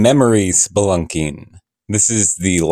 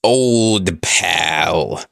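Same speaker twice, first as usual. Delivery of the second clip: gravelly voice; 5 semitones lower than usual. Acted. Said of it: "in, like, a gravelly voice".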